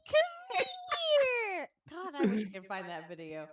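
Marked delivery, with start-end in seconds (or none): 0.1-1.7 high sing-song call, louder. Acted. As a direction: coax him over with a high, drawn-out call. Calling NPC to come up.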